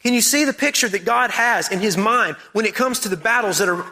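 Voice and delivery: ordinary talk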